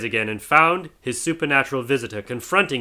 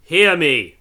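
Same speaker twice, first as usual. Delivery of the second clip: louder, pressing call; urgent cry; 5 semitones higher, 7 dB louder than usual. Acted. Marley's ghost urging Scrooge, loud. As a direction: demand to be heard. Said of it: "cried the ghost".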